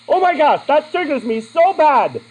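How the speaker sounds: high, loud, exasperated outburst; irritated YouTuber impression. YouTuber reacts to irritating pictures, loud mock exasperation. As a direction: mimic an over-the-top triggered gentleman, drawing out the last words. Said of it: "Anybody know that voice?".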